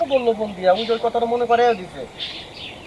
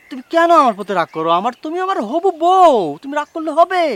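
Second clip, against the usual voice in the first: loud, higher-pitched protest to a fiancée; pleading protest; 4 semitones higher, 7 dB louder than usual. Acted. Boyfriend coaxing his annoyed fiancée, loud pleading protest. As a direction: plead loudly, stretching the words.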